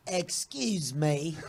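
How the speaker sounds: slow, drawn-out mock British accent. Shown as a demonstration. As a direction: politely stretch it out in British. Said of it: "do your best British accent".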